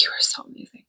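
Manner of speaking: breathless whisper of awe; hushed amazed whisper. Retold or imitated. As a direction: whisper it, dazzled. Whispered praise after massage.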